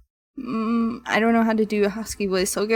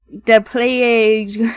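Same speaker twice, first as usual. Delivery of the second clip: loud, long-drawn mock alarm; hammed-up cry; 7 dB louder, much slower than usual. Player echoes the villager's dread word, hammed-up cry. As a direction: belt it out slowly, milking every vowel.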